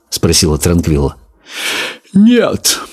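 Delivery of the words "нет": very high lazy lizard drawl. A narrator's reading. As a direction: stretch it out lazily. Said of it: "лениво протянул".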